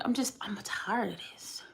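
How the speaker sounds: whispered, worn out; tired whisper to herself; weary whisper. Real, not acted. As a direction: breathe it out, drained. Venting, worn out.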